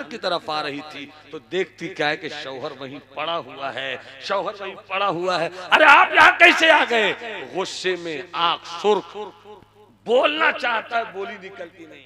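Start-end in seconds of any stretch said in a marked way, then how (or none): 5.7-7.1 startled exclaim. Acted. Wife startled by husband.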